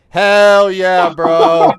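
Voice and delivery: loud, long drawn-out hype yell; hyped cheer. Real, not acted. Streamer cheering a favourite player, hyped. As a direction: belt it out, stretching each word.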